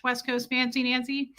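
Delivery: usual speaking voice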